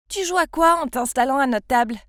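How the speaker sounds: interpellation sèche et plus forte; lance-la comme une provocation de cour de récré; ton agressif. Acted. Fille agressive à la cantine. (une fille hostile à la cantine, agressive et forte)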